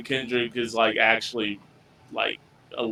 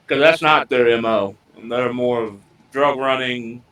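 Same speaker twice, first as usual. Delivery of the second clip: ordinary delivery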